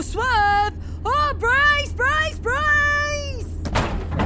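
high panicked yell; panicked shouting brace. Acted. Pigeon ahead on road.

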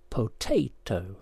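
"potato" slowly as po, tay, toe; syllable by syllable. Shown as a demonstration. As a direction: split "potato" into three full, slow syllables. Puzzle answer shown by syllables.

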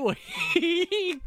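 laughing. Real, not acted. Laughing at own corporate talk.

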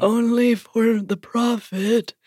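slow, gross cult leader voice; creepy prophet voice. Retold or imitated. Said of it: "his voice is so gross".

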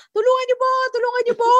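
high yell for help, reliving the run; retold cry for help. Bleeding woman on the street calling for help, high yell. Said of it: "nagsisigaw ako na".

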